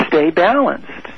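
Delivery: ordinary talk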